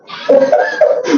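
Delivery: high-pitched short laugh. Real, not acted. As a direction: break into a high little laugh. Rit des ancêtres belges. (petit rire aigu)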